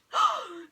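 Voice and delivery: sharp high gasp; a sudden loud startled gasp; alien gasp. A narrator's reading. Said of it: "Another alien gasped".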